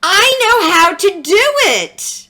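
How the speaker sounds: high, loud excited call; a girl hollering to her teacher. A narrator's reading. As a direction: shout it out, sure of herself. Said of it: "Zoe hollered".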